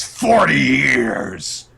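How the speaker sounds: slow, loud drawn-out bellow; slow booming declaration; triumphant shout. Retold or imitated. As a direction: roar it out like a monster claiming its prize. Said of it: "she declares".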